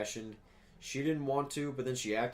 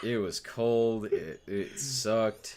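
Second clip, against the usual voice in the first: dragging each word out slowly; slow, drawn-out complaining; 3 dB louder, much slower than usual. Real, not acted. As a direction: groan it out slowly. Groaning about a cold walk.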